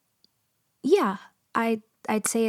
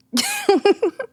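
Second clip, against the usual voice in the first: high burst of laughter; 7 dB louder than usual. Acted. Nervous outburst mid-conversation.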